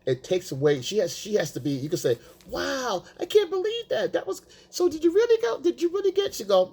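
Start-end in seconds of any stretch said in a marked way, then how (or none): 2.5-6.3 much higher, over-eager amazement; mock gushing. Mocking a gushing boyfriend who overreacts to her story, much higher. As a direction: gush like a boyfriend hanging on every word.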